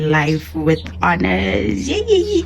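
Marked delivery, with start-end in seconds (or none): 1.9-2.4 high squeal of delight; a thrilled little yay, much higher; excited cheer. Real, not acted. Teacher cheering over the balloon gift, excited.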